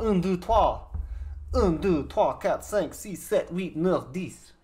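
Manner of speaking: counting in a hammed-up French accent; playful French accent. To the viewers after a cursing joke, mock French accent count. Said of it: "Excuse my French, my Francois".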